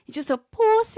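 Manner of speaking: a hammed-up falsetto jeer; mock shriek. Mocking shriek in banter.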